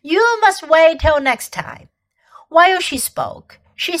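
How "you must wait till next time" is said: lightly voiced teasing queen, a bit louder; a hint of amused refusal; queen teasing Edmund with a promise. A narrator's reading.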